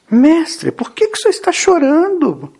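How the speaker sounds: high-pitched, earnest appeal; concerned questioner. Acted. Someone asks Jesus why he weeps, high concerned plea. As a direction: ask the master, voice lifted high. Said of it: "Alguém tinha que perguntar a Jesus".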